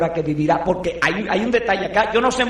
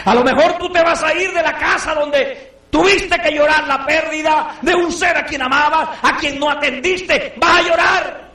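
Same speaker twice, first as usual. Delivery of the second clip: fervent preaching; 8 semitones higher than usual. Acted. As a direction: drive the warning home. Preaching at full force.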